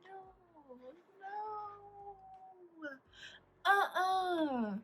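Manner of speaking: soft, high, whimpering no no; horrified no. Retold or imitated. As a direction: quietly plead from behind, high and pained. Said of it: "standing in the back like".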